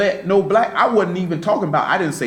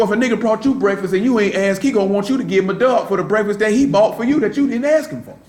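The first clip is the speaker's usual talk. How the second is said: usual speaking voice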